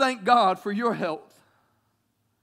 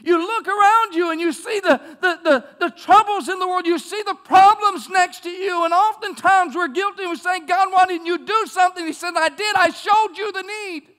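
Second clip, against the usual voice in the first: loud preaching voice, building higher; full-force preaching; 7 semitones higher, 8 dB louder than usual. Acted. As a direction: preach it out big. Preaching climax, God's replies.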